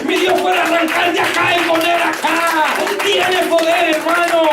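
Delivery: preacher rousing the congregation; preaching. Real, not acted. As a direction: drive it home to the brothers. Preacher proclaiming God's power.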